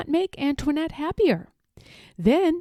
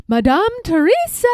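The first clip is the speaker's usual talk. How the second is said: louder, higher call across the palace; 7 semitones higher, 6 dB louder than usual. A narrator's reading. Nurse calling the child in from the park, loud. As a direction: call her name from afar. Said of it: "called a nurse".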